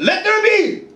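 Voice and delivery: high, ringing creation command; God's command. Acted. Preacher voicing the Creator, God's command. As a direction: proclaim it high and sudden. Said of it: "He said".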